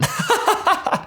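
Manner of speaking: quick breathy laugh at his own joke; a little unvoiced chuckle; real amused laugh; short laugh. Real, not acted.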